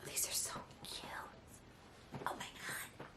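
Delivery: whispered, as if about to cry; barely voiced, touched whisper; overcome whisper. Real, not acted. Vlogger overcome by cute Dobby socks, whispering. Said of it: "I'm gonna cry".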